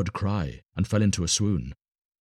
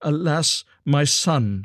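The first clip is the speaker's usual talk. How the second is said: high, raised lament; pleading, high-pitched wail of words; anguished cry; 8 semitones higher, 5 dB louder, slower than usual. A narrator's reading. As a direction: cry out in sorrow. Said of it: "she cried".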